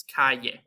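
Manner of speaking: "calle" with the LL as a Y, "ka-yeh"; correct Spanish calle. Shown as a demonstration. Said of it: "So it's not".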